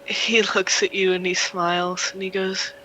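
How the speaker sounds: ordinary talk, nothing marked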